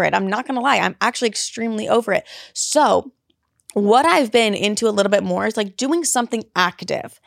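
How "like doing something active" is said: normal talking voice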